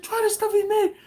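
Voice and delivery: very high, loud excited call; excited coworker. Retold or imitated. Coworker urging his wife to try the sauce, excited impression. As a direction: mimic him eagerly calling his wife over. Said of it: "He's like".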